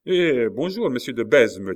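salut enjoué, plus haut et fort; admiratif. A narrator's reading. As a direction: saluer un héros avec enthousiasme. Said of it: "avec une sorte d'admiration". (Coconas salue Besme avec admiration)

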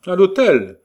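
an impatient shouted command. A narrator's reading. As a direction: bark the order, fed up. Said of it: "répondu avec impatience".

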